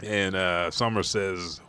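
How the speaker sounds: ordinary talk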